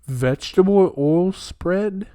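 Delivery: slow, high, incredulous read; can't believe what the tub says; stunned disbelief. Real, not acted. Reading the butter label, slow and high in disbelief.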